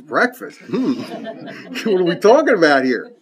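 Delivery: mock-nervous pig with a laugh; a little sweaty. Pig questions chicken's breakfast idea, mock-nervous. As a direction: play the pig getting uneasy, lightly hammed up. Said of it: "Pig gets a little sweaty".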